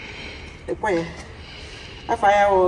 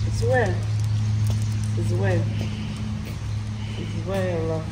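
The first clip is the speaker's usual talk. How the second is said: much softer, fighting back tears; holding back tears; 3 semitones lower, 10 dB quieter, faster than usual. Real, not acted. Daughter quietly telling her late father she misses him, near tears. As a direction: speak low and fragile, trying not to cry. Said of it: "I don't want to cry".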